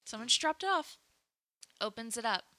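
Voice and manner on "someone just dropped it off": lightly voiced young woman; breezy high answer; light high girl voice. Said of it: "She's like".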